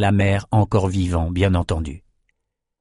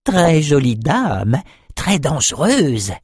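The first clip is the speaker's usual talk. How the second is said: higher, slow, strange confiding little voice; creepy whisper of a runt; 5 semitones higher, much slower than usual. A narrator's reading. The runt confides to Bannon, slow and eerie. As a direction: lean in and share it like a secret. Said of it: "l'avorton lui murmura".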